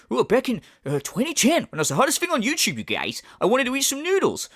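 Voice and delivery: mock boastful DSP. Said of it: "you're going".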